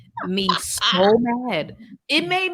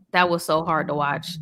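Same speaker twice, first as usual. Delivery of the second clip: usual speaking voice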